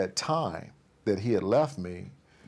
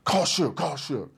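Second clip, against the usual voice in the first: higher, punchy retold order; insistent, pitched-up mimicry; impatient demand; 6 semitones higher, slower than usual. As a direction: imitate an antsy man demanding a phone call. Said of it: "He's getting pissed".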